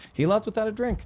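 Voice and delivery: ordinary talk, nothing marked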